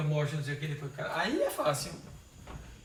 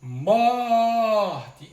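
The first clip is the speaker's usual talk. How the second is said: "Morte" louder, the first syllable "mor" hit hard; stress on MOR; 5 semitones higher, 9 dB louder than usual. Shown as a demonstration. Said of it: "Qual é a sílaba tônica de morte". (ênfase forte em "mor" de "Morte")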